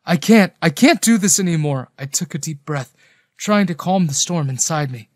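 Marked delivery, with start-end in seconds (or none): 0.0-1.9 high, loud, at breaking point; overwhelmed outburst. A narrator's reading. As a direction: burst out, unable to take more. Overwhelmed, right after shouting stop.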